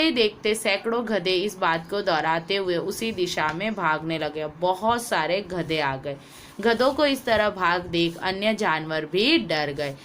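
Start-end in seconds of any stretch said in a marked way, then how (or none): none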